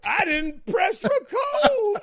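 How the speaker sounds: very high, slow, whiny near-sob; impression of Camille. Mocking the host's lost recording, high whimpering squeak. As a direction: whimper it in a tiny pitiful voice. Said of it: "my new impression of Camille".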